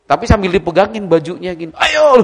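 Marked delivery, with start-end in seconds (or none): 1.8-2.2 high loud cry of "Ayo!" as if falling; crying-out scare. Retold or imitated. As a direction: shriek "Ayo!" while grabbing the shirt. Grabbing friend's shirt as prank. (teriak sambil menarik baju teman)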